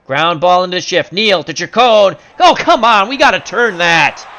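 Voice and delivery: urgent call turning exasperated. Pretend. Announcer sees the double play slip away, loudly exasperated. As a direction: build from live call to frustrated yell.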